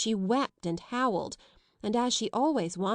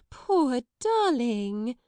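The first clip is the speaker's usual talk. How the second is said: high, very slow coaxing; soothing a screaming child; cooing; 4 semitones higher, 4 dB louder, much slower than usual. A narrator's reading. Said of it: "she said one moment".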